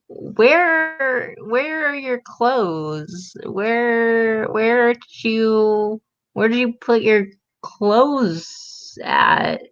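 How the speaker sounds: drawn-out, slow, baffled demand; incredulous questioning. Real, not acted. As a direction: stretch each question out in disbelief. Mock outrage at an empty closet.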